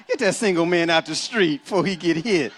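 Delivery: usual speaking voice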